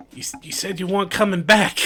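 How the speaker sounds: high, quick, stammered protest; fearful shop owner. Acted. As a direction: stammer it out in alarm. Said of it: "just this fear in her eyes".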